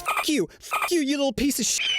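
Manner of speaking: high-pitched mock fury; Karen outburst. Angry mother cursing him before security, mock Karen outburst. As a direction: imitate an enraged woman, voice pushed way up. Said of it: "The Karen says".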